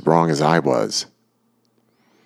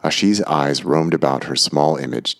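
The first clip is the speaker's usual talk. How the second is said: ordinary delivery, nothing marked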